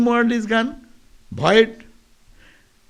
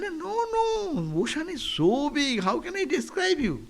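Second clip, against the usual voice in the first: much higher, pleading to be believed; ocean frog insisting; 7 semitones higher, 4 dB quieter than usual. Acted. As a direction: reach up in pitch as the big frog. Ocean frog explains, story.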